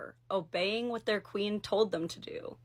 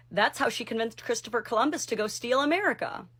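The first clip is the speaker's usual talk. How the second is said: ordinary delivery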